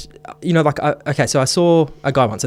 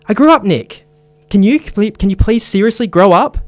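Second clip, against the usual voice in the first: put-on higher, louder, exasperated girlfriend; angry pleading; 8 semitones higher, 6 dB louder than usual. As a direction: beg him sharply to grow up. Ashley confronting drunk boyfriend.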